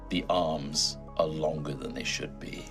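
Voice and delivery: ordinary delivery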